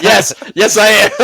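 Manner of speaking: high loud laughing yes; laughing mock answer. Imitating a flat-footed customer, laughing. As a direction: laugh through the reply.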